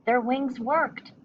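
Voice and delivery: hope she can fly too; hopeful. A narrator's reading. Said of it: "thought Stella Luna".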